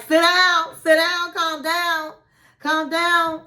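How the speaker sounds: insistent retold pleading order; urgent calling out. Retells Matilda urging him to sit.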